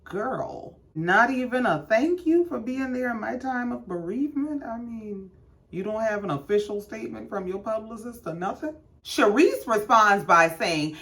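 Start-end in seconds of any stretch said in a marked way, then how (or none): 4.6-8.7 softer, quick sarcastic side comment; dry, soft aside mocking the snub. Real, not acted. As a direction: mutter it like a catty aside.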